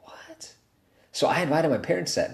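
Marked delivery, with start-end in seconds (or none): 0.0-0.5 whispered in disbelief; stunned whisper. Retold or imitated. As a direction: breathe it out, shocked. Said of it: "I'm like".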